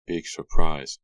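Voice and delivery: dry, mocking jab; sarcastic. A narrator's reading. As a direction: say it with a sneer. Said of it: "Suki said sarcastically".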